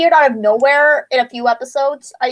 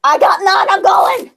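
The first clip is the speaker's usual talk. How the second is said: panicked shout; 5 semitones higher, 4 dB louder, slower than usual. Acted. Answering the killer, bailing out.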